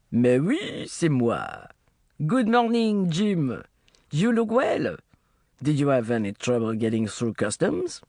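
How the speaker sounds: put-on smooth, easy French accent; charming Frenchman greeting old friends, French accent; René's light French accent, unhurried. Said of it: "thick with a French accent".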